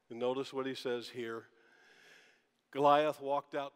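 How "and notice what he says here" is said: normal talking voice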